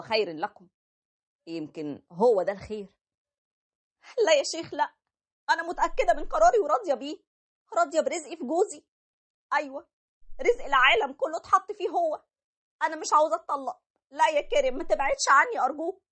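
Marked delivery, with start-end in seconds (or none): 4.3-16.0 high, tearful wife begging him to stay; pleading wife. Acted. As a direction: beg your husband desperately not to divorce you. Wife refuses divorce, pleads.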